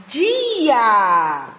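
"Dia" stretched long on both vowels; drawn-out syllables. Shown as a demonstration. Teacher blending two syllables for the class, drawn out. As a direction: glide slowly from "Di" into a long "a". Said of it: "a junção dessas sílabas".

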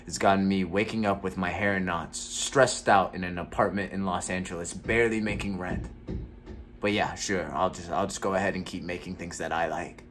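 a jaded actor venting alone; gloomy. Acted. Actor performs a bitter monologue.

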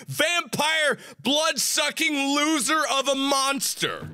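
high, slow string of insults; yelled insults at a game monster; cursing tirade. Real, not acted. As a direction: spit every insult at the monster.

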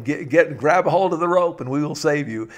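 urgent storyteller's rescue call. Retold or imitated. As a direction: call down to someone stranded below. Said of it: "they cried down to hi".

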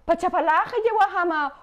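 mother threatening her kid with a sandal, a mock scolding; scolding mom. Mother to child about the sandal, mock threat. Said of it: "our moms would say".

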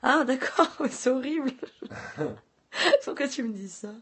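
real alarm breaking into a laugh; shocked surprise. Real, not acted. Streamer hears what swatting is, shocked.

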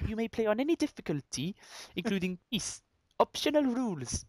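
high, lilting mock-Welsh; bad Welsh accent. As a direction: attempt Welsh, amused at yourself. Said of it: "I can't do Welsh either".

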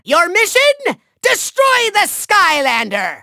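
normal talking voice

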